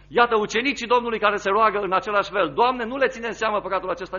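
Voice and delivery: a preacher's rising declamation; impassioned preaching. Acted. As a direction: proclaim it to the congregation. Preacher reading scripture aloud.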